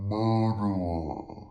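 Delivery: very low, loud, drawn-out ghostly voice; spooky Ouija board. Acted. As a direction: intone it like a sinister spirit. Voices what the Ouija board spells.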